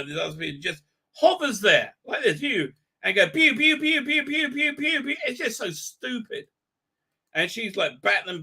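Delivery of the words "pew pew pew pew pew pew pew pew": high mock blaster pews, pew pew pew; silly blaster sound effect; blaster pew noises. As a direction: imitate laser fire, pitched way up. Imitating blaster fire.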